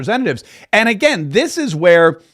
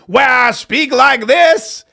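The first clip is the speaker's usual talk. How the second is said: hammed-up Southern accent, pitched up; put-on Southern accent; 7 semitones higher, 4 dB louder, much slower than usual. Shown as a demonstration. Host asks about doing two shows, mock Southern accent. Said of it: "get this Southern accent going".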